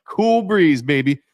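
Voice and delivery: playful impression of a laid-back dude; smooth dude greeting. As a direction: croon it like a cool old-school guy. Imitating Cool Breeze's greeting.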